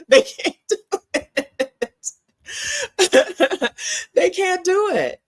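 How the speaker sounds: high, slow, laughing "They can't do it". Real, not acted. Laughing through repeated punchline.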